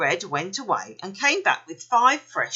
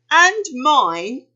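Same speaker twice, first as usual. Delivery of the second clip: louder, higher moan; 7 semitones higher, 9 dB louder than usual. A narrator's reading. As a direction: moan it like a put-upon joey. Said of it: "moaned Thumper".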